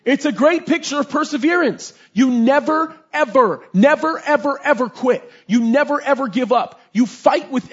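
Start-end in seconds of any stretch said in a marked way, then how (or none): 0.0-1.9 louder and higher, building fervour; preacher swelling into full force; rising preaching. Real, not acted. 2.1-6.8 never and ever punched one by one; pounding out never ever quit; emphatic never ever. Real, not acted. Preacher hammering never ever.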